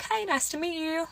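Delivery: sweet but hollow hello; forced politeness. Retold or imitated. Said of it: "I'm like".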